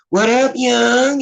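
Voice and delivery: high, slow Baltimore street greeting; Baltimore slang. Retold or imitated. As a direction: stretch it out way up high, Baltimore style. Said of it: "they talk like that".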